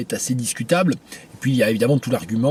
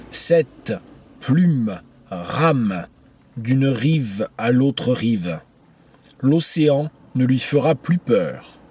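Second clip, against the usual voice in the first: slow, measured poem recital; poetry reading; 2 semitones higher, slower than usual. Acted. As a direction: read the verse aloud slowly, pausing between phrases. Lit le poème à voix haute. (lecture de poème, lente et posée)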